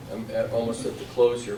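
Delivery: normal talking voice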